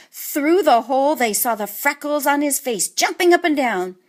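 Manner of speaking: plain reading voice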